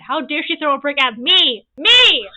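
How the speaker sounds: theatrical affronted protest; mock outrage. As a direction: play wildly offended, building to a shout. Mock outrage at sister's brick.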